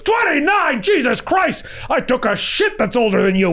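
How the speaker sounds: put-on very high, disbelieving old showman; George Schlatter. Producer mocking the writer's age, high outraged disbelief. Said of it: "He goes".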